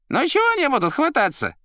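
very high, fast, loud patter; Koroviev. A narrator's reading. Koroviev reassuring the Master, high quick patter. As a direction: brush the worry off in a squeaky voice. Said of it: "успокоил Коровьев".

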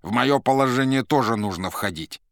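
громко и повышенным тоном, теряя терпение; громкая досада уставшего уговаривать посланца; раздражённые уговоры. Acted. Азазелло раздражённо уламывает Маргариту. (раздражённо, с досадой)

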